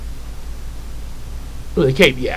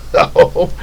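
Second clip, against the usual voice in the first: ordinary delivery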